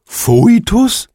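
hoch gezogenes, stöhnendes Fragewort; stöhne die Frage entsetzt hinaus; gequältes Stöhnen. A narrator's reading. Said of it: "stöhnte der Phönix". (der Phönix stöhnt entsetzt auf)

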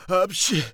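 loud, high "hatschi" sneeze; acted sneeze. A narrator's reading. Sneezing chestnut man interrupts his own answer, explosive sneeze. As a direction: burst out in a big stage sneeze. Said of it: "nieste das Männlein".

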